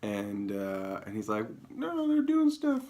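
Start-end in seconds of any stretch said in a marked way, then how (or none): 1.7-2.8 very high, slow, strained whine; Trump impression. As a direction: squeak it out slowly like a whiny Trump. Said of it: "That's my impression".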